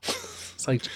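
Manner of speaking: usual speaking voice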